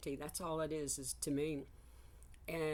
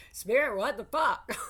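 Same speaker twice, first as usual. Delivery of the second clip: loud, high laughing cry of disbelief; exasperated outburst; 8 semitones higher, 11 dB louder than usual. Retold or imitated. Venting at Spirit about her troubles, loud laughing exasperation. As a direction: throw it up to Spirit, half laughing. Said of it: "I'm like".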